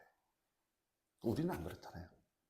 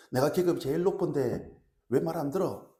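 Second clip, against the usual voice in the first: loud, raised-pitch indignant demand; angry sergeant; 4 semitones higher, 6 dB louder than usual. Acted. Preacher acts out top-ranked sergeant scolding juniors, angrily. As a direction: pull rank on a disobedient junior. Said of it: "분노하죠".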